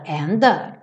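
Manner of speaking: usual speaking voice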